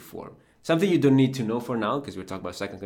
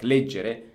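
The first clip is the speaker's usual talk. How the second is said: leggere with stress on the first syllable; stress moved left; 3 dB louder than usual. Shown as a demonstration. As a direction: say leggere as LEG-ge-re. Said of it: "the accent was one to the left".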